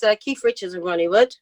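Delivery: ordinary talk, nothing marked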